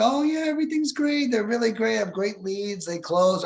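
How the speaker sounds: high, chirpy fake-cheerful seller; sing-song overly positive; cheery seller. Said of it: "some of these people are like".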